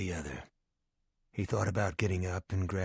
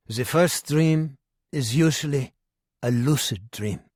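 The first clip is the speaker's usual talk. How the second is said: put-on lecturing old German psychiatrist; knowing German doctor, explaining; German accent; 6 dB louder than usual.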